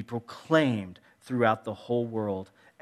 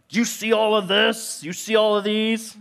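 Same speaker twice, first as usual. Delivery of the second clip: much higher, louder, scolding questions; exasperated parent; 9 semitones higher, 6 dB louder than usual. Retold or imitated. Parent scolding kids about their messy room, exasperated. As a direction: scold the kids over the mess. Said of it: "the way I talk as a parent".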